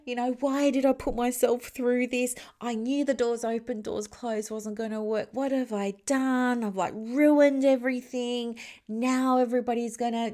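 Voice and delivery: high, anxious self-talk in a mock panic; panicked inner voice. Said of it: "I'm like".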